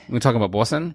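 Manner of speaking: thick Boston accent. Shown as a demonstration. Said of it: "I can do a Boston accent".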